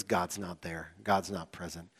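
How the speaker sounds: low, soft, let-down verdict; deflated, as if giving up on God; mock dejection. Preacher voicing Israelites in bad times, mock dejected. Acting out bad-circumstances reasoning.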